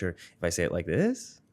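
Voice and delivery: "this" lifted upward like a question; rising question tune. Shown as a demonstration. Guest showing how pitch changes meaning, rising question tune. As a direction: pitch climbs on THIS as if asking. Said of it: "If my pitch goes up".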